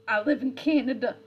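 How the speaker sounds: a Scottish-flavoured protest; mock Scottish accent. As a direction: insist in a hammed-up Scottish accent. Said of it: "like, Scottish".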